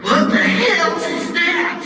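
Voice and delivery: high, slow startled shout; shocked Raptor. Acted. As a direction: yell it out in alarm, stretched.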